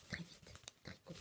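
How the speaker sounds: quick whispered aside. Real, not acted. Announcing knitting talk to viewers, a whispered aside. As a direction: murmur it to yourself under your breath.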